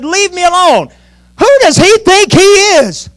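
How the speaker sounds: high, loud, drawn-out indignation; defiant outburst. Acted. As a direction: build to an indignant cry as young David. Acting defiant David shaking off brother.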